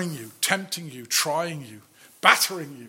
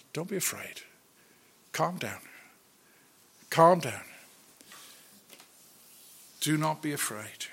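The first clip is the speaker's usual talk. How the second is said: soothing; 5 dB quieter than usual. Retold or imitated. Soothing a panicked child.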